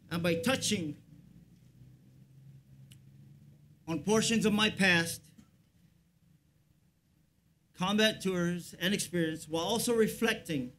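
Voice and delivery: measured, solemn ceremonial address; formal speech. Acted. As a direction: deliver a stately public speech. Formal Memorial Day speech.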